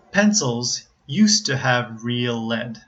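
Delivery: slow, careful model reading; practice sentence. Shown as a demonstration. Teacher gives a sentence to practice, slow model reading. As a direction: read it slowly for learners to copy. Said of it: "sentences that perhaps you could practice".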